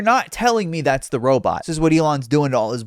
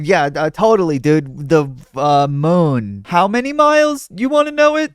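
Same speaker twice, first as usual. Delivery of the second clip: mock operator voice; 7 dB louder than usual. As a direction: play the guy inside the suit, loudly. Mocks operator inside robot suit.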